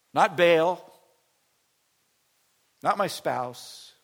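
loud, slow, punched list; preaching at full force. Acted. Pastor rejecting false shepherds before church, loud preaching. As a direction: strike each "not" hard.

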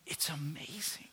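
hushed amazement, almost to himself; awed whisper. Real, not acted. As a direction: breathe it out in a whisper. Preacher marvels in hushed aside.

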